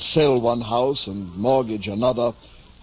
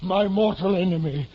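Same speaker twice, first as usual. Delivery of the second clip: grand declaration; 7 semitones higher than usual. Acted. Knight denounces his enemy.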